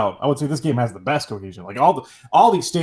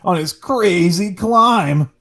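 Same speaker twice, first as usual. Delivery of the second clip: slow, hyped trailer voice, raised pitch; movie trailer announcer; 5 semitones higher, slower than usual. Acted. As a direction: announce it like a summer blockbuster. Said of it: "Sounds like a, a movie".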